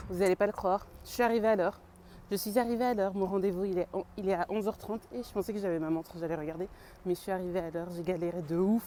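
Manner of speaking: usual speaking voice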